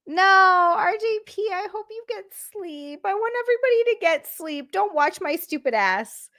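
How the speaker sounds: high-pitched, whiny plea; pleading. Real, not acted. Streamer urging tired viewers to sleep, pleading. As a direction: beg them in a high, fretful voice.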